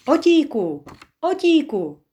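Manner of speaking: high, loud happy shout across the bridge; a boy yelling his cousin's name in delight; joyful calling. A narrator's reading. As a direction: call out to him with relief. Michal sees his cousin.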